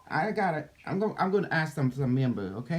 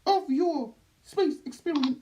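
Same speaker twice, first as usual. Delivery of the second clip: high alien reptilian voice; otherworldly being, high and strange; Doctor Nucky; 13 semitones higher than usual. Acted. Channeled being speaks.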